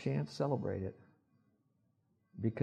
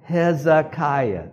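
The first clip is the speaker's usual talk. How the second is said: teaching pronunciation; 3 semitones higher, 5 dB louder, much slower than usual. Shown as a demonstration. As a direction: lead the room through "Hezekiah", drawn out. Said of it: "Can you say that?".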